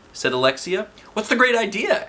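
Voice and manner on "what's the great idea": eager question. Acted. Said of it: "asked Michael".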